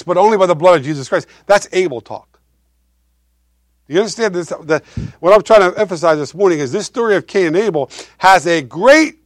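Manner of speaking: ordinary talk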